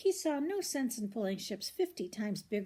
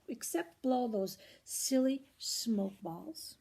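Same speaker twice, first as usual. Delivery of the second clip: usual speaking voice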